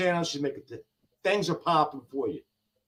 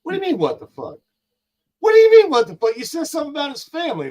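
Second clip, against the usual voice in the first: sudden high, loud outburst that then runs fast; retold angry rant at critics over family talk; heated retort; 6 semitones higher, 4 dB louder, faster than usual. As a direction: fire back in disbelief at the insult. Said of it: "I'm like".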